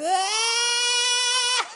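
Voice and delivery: imitating a friend's squealing high laugh; friend's squealing laugh at the kickoff return; high-pitched laugh. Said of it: "Bartnick has this high-pitched laugh".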